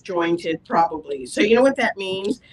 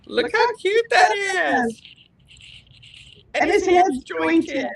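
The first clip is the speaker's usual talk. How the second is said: gushing squeal; 8 semitones higher than usual. Real, not acted. Gushing over jointed skeleton charm.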